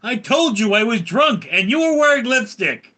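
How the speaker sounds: loud, high mock-defensive outburst; hammed-up protest. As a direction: blurt out the punchline as a mock protest. Acted retort as joke punchline.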